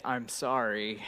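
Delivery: low, hushed, sincere sorry; soft apology. Retold or imitated. Dad still apologising to his daughter, quietly sincere. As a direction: murmur it gently to the child. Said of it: "I'm still saying".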